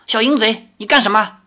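high-pitched indignant rebuke; angry. A narrator's reading. Girl rebuking Li after slapping him, indignant. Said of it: "那少女怒道".

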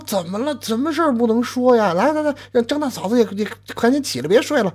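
high, hurried coaxing; urgent coaxing. Acted. Neighbour hurrying the couple out of the fire, high coaxing. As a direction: hurry them up, pitched high. Neighbour coaxes him to rise.

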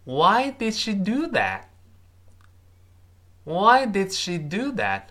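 measured demonstration, lifted pitch; slow model reading. Shown as a demonstration. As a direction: read it out slowly for learners to copy. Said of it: "他这么说".

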